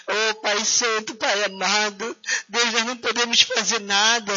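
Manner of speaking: ordinary delivery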